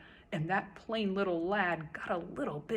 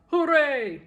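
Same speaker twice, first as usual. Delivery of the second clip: high, loud cheer; shouted; 6 semitones higher, 11 dB louder than usual. A narrator's reading. King cheering at his new height, shouting. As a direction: whoop with triumph. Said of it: "shouted Yertle".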